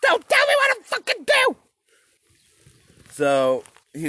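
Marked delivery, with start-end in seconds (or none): 0.0-1.6 screeching high yell, hammed-up; mock furious shout. Henry yelling back at the church, mock shout. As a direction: shriek it like a spoiled king. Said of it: "Henry the eighth was like".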